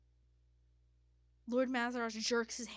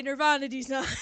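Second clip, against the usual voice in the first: loud, high, laughing shout; 5 semitones higher, 12 dB louder than usual. Shown as a demonstration. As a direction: shout the meme line loudly. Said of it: "As the kids would say".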